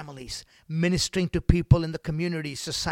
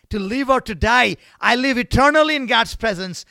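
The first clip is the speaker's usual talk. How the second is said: high and loud; loud proclamation; 7 semitones higher, 10 dB louder than usual. Acted. Preacher to congregation, proclaiming loudly. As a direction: declare it with joy.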